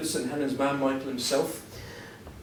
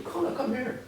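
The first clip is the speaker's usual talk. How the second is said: softer and higher, a hushed mock call; imitating a quiet call; 10 semitones higher, 6 dB quieter than usual. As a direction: call him over gently, softer and higher. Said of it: "he's just whispering quietly".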